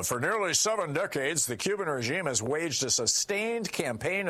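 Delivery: high, swooping mock-official proclamation; hammed-up Red Scare alarm; mock-alarmist read-out. Mocking read-out of McCarthyite document.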